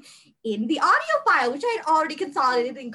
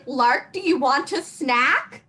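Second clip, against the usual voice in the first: slow, insistent mothering offer; mom friend; slower than usual. Retold or imitated. Mom friend offering Lark snacks, slow and fussing. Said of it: "I'm a mom friend".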